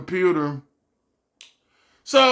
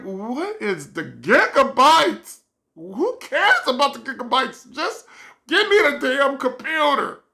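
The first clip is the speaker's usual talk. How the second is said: exasperated; 6 semitones higher than usual. Retold or imitated. Exasperated inner rant.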